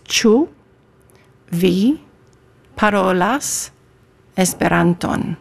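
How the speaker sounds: "Ĉu vi parolas Esperanton" word by word, pausing after each; pronunciation demo. Shown as a demonstration. Guest teaching host "Ĉu vi parolas Esperanton", careful pronunciation demo. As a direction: model "Ĉu ... vi ... parolas ... Esperanton" for a learner. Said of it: "guide me through the pronunciation".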